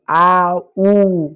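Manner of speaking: आऊ stretched into two long vowels, aa-oo; sound demo. Shown as a demonstration. Teacher showing students the OU sound, आऊ drawn out. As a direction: draw आऊ out slowly, aa then oo. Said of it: "O यू को आऊ पढ़ते हैं".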